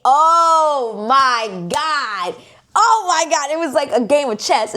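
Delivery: high, drawn-out fangirl exclamations; ecstatic gushing. Real, not acted. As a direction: wail it in sheer delight. Reliving a thrilling showdown.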